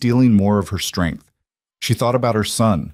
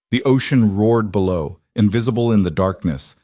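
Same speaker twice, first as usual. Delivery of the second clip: usual speaking voice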